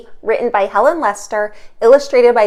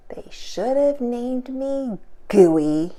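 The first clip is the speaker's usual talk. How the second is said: slow, soft, sighing gloomy porcupine; soft, slow disappointed sigh; 6 dB quieter, much slower than usual. Acted. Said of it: "he sighed".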